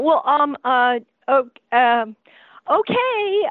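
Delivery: acted-out reluctant giving in; hesitant. Secretary unsure about letting an unannounced actress in, hesitant.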